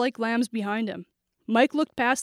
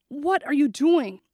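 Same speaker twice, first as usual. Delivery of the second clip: higher, drawn-out strained question; strained, pained voice; 4 semitones higher, slower than usual. A narrator's reading. Billy facing the gunman, strained and frightened. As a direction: squeeze it out as if winded. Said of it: "sounded like someone had kneed him".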